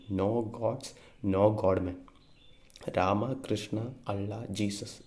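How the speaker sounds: ordinary talk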